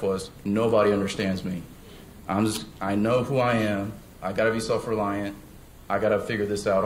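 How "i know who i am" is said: normal talking voice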